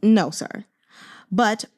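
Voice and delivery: ordinary talk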